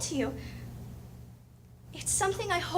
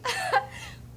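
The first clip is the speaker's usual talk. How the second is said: short bright laugh, a touch higher; light laugh; 5 semitones higher than usual. Acted. [name] laughing at knocking Father down, light giggle. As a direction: let a giggle spill out. Laughing at her own story.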